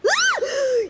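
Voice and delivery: sharp frightened gasp; startled gasp. Real, not acted. Paimon startled by Father, sharp gasp. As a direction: gasp at a sudden voice. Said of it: "You scared Paimon half to death".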